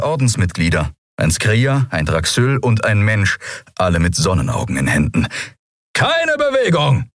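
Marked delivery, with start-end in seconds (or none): 5.9-7.1 high, stretched shouted order; commanding shout. A narrator's reading. Armed man halting two fugitives, high commanding shout. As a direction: shout it to freeze them on the spot.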